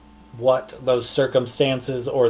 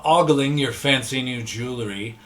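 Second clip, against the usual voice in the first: ordinary delivery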